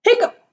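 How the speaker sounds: squeaky high hiccup sound; a sudden high-pitched hiccup. Acted.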